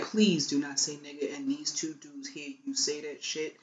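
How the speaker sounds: soft, low, urgent hush; urgent low plea. Retold or imitated. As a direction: beg him under your breath. Pleading quietly to drunk man.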